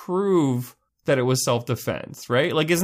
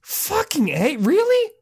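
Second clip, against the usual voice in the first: squeaky disbelieving cry, much higher; high incredulous outrage; slower than usual. Retold or imitated. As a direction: shriek your disbelief at the radio. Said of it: "you're just like".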